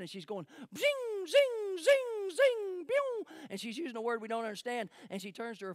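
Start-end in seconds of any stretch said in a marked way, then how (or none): 0.8-3.3 high, sharp zing sounds of bullets flying past; imitated bullet whizzes. Soldier girl mimicking bullets zinging past her, high and sharp. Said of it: "she starts saying a word we don't understand".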